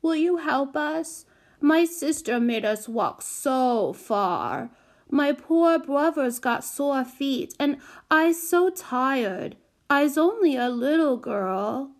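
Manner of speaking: put-on high, slow, pitiful baby talk; wheedling little girl. A narrator's reading. Small child begging the carter for a lift, wheedling. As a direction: lay on the poor-little-me plea, stretched out. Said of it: "doing the batting thing with her lashes".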